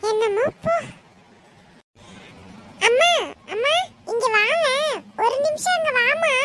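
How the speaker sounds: put-on playful kid voice; young boy poking fun; teasing little brother. Boy coaxing his mother.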